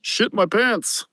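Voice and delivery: squeaky imitation of the worker's Mexican accent; mock-scared Mexican accent. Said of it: "He said".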